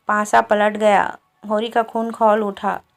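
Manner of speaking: ordinary delivery, nothing marked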